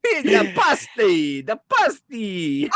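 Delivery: hammed-up high, slow cry; mock caricature shout of food. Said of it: "Stereotypical voices".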